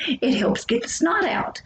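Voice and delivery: ordinary talk, nothing marked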